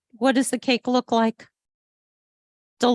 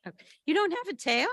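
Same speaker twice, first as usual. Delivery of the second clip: ordinary delivery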